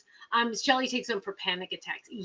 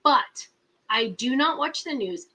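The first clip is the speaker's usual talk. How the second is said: ordinary talk, nothing marked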